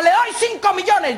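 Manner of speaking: high, slow call from a crowd; shouted bid. Acted. Man in crowd bids for the cow, calling out. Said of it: "Gritó un man".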